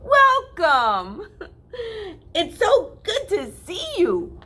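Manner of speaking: high, loud, laughing greeting; excited welcome. Acted. Country Mouse greeting her cousin, bubbly and laughing. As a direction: gush over the visiting cousin.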